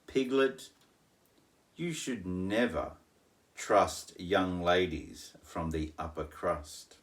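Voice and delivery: ordinary delivery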